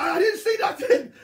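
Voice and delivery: high, loud, laughing through it; laughing I-saw-nothing; laughing denial. Retold or imitated. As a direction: deny it while cracking up. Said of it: "I was like".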